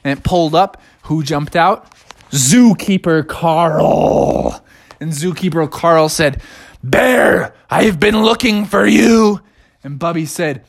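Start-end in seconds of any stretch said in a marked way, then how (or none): 2.3-4.6 very slow, drawn-out name; dramatic reveal. A narrator's reading. Storyteller revealing who jumped out, drawn-out and dramatic. 6.8-9.4 higher, emphatic storybook zookeeper; confrontational zookeeper. Acted. Zookeeper finally meeting the bear, confrontational.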